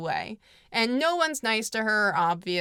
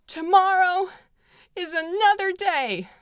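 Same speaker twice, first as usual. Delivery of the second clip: much higher, louder dramatic southern belle; hammed-up Scarlett; 9 semitones higher, 4 dB louder than usual. Scarlett at the end of the movie, hammed-up high and hopeful. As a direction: overact the hopeful final line. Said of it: "And she goes".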